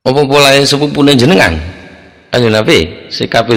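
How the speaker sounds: a complaining neighbour confronts the Prophet, indignant and loud; indignant protest. Acted.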